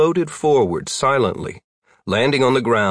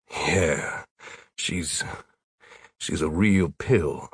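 deflated; 4 semitones lower, 6 dB quieter, much slower than usual. A narrator's reading. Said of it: "Tyler deflated".

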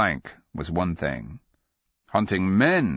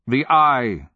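high, loud, sudden retort; angry snap; 6 semitones higher, 7 dB louder than usual. A narrator's reading. Answering the old wizard about his loss, snapping. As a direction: snap it out, pitched up and louder. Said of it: "she snapped".

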